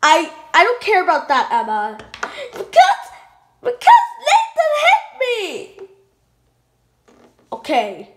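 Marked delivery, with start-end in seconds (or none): 2.5-5.9 put-on high child voice. Child doll voice tattling.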